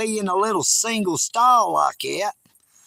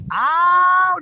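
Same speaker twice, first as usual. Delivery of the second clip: sharp, much higher and louder oh; big exclamation; 11 semitones higher, 6 dB louder than usual. Acted. Hillbilly persona exclaiming loudly. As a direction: fling out a loud oh. Exclaims before redneck bit.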